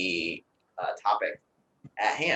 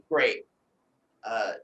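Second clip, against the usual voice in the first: normal talking voice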